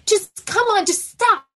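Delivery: high-pitched exasperated parent snapping orders; re-enacted fed-up outburst, climbing to a shout; mad and done. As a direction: order the kid out, at the end of your rope. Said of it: "I was so fucking mad and done".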